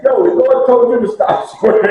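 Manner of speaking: lightly voiced heckler, amused; teasing inmate. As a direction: rib him for swearing.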